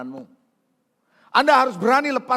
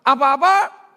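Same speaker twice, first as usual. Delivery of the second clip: higher, loud call to the crowd; rousing call; 6 semitones higher, 11 dB louder, slower than usual. Acted. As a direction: shout it for them to answer. Preacher calls for crowd response. (seruan keras mengajak jemaat menjawab)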